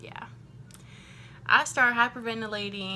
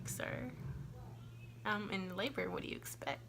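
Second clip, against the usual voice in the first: muttered retort; 11 dB quieter than usual. Retold or imitated. Patient in labor thinking back at her doctor, muttered retort. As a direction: say it under your breath.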